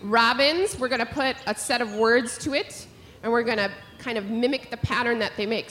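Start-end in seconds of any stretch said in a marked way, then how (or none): none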